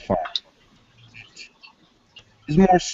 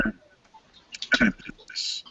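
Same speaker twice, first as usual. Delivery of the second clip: whispered, slow and hesitant; soft unvoiced hesitation; whispered aside; 9 semitones higher, 7 dB quieter, slower than usual. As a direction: drop to a whisper while searching for words. [name] hesitating mid-explanation.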